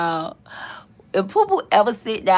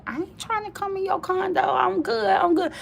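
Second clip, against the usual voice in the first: higher, brushing him off; mock dismissive; 13 semitones higher than usual. She refuses to come to his condo, higher and dismissive. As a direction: wave it away, not interested.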